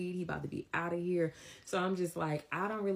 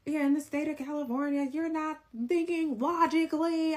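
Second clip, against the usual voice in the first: exasperated arguing; 14 semitones higher, 5 dB louder than usual. Retold or imitated. Man telling her off by phone, exasperated mock yelling. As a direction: mimic his strained, high-pitched yelling. Acted phone quarrel, his side.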